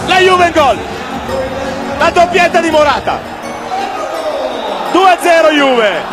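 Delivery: rapid stuttering goal shouts; breathless Italian goal commentary. Real, not acted. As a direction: fire off the gols in bursts. Morata goal call.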